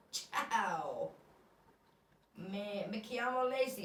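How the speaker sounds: slow, lightly Italian greeting; Italian accent. Retold or imitated. Greeting Spanish landlords in Italian, slow. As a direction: introduce yourself the Italian way, unhurried. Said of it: "I'm speaking Italian with everyone".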